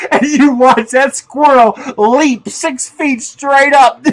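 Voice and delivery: loud, high, drawn-out glee; gleeful storytelling. Real, not acted. Telling how the squirrel leaps, loud laughing glee. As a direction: stretch it out loudly, laughter bursting through.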